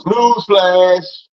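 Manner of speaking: loud-ish, high, drawn-out mock bulletin; mock news flash. Preacher bursting the congregation's bubble, mock news flash. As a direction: call it out like a sarcastic headline.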